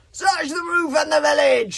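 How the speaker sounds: high, loud screamed order. A narrator's reading. Warlord screams orders to search the roof, high and loud. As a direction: scream the order across the hall. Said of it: "screamed Norbert the Nutjob".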